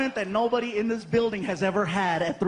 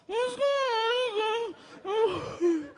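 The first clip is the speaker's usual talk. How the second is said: put-on high, very slow, drawn-out groggy child voice; sleepy kid; 13 semitones higher, much slower than usual. Frankie waking at three a.m., slow high sleepy kid. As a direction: stretch it out like a half-asleep boy. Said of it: "and he's all".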